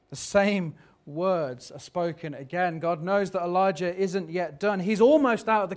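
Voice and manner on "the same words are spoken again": ordinary delivery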